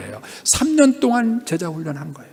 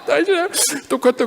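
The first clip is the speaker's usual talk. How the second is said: amused teasing; 8 semitones higher, faster than usual. Retold or imitated. Wife's teasing remark, retold with laughter.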